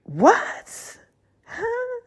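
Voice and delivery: choked disbelief. Retold or imitated. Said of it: "I'm choking like".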